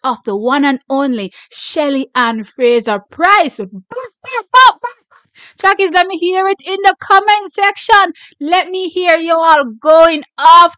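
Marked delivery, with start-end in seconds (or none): 5.6-10.8 high, loud hype; rally the audience, shouting. Real, not acted. Urging viewers to comment.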